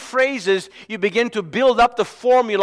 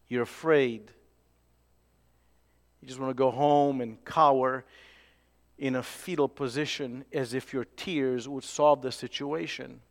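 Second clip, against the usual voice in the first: much lower and softer, sinking away; hushed and low; 7 semitones lower, 11 dB quieter than usual. Acted. Preacher speaks softly and low, picturing a frightened listener. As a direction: let it sink like someone cowering.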